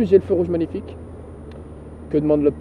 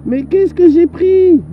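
mock drugged; 10 semitones higher, 7 dB louder, slower than usual.

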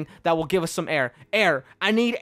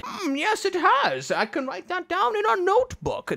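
much higher, pompous little sidekick voice; 11 semitones higher than usual. Acted. Bobbin the sidekick agreeing about the notebook, in a high, smug voice. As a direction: play the smug companion, high.

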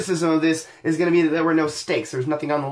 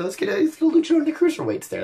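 excited; 12 semitones higher, faster than usual. Real, not acted. Excited pitch for a luchador.

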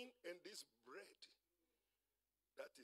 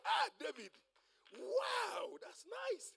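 high, drawn-out wonder; amazed greeting; 13 semitones higher, 4 dB louder, much slower than usual. Acted. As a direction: act out a thrilled meeting with a hero. Acted amazed meeting with David.

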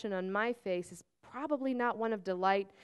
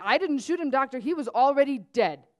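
louder, urgent denial; alarmed protest; 3 semitones higher, 8 dB louder than usual. Acted. Marek protests to the doctor, loud and alarmed. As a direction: blurt it out in alarm. Marek nearly falls from his seat.

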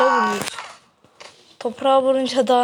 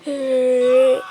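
ordinary talk, nothing marked

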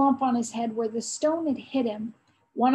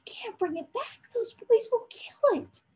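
anguished pleading; 10 semitones higher than usual. A narrator's reading. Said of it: "cried Joris in anguish".

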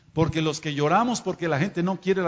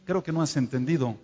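normal talking voice

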